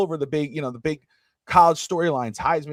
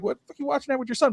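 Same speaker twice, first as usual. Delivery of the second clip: incredulous me; 10 semitones higher, much faster than usual. Retold or imitated. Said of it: "I'm like".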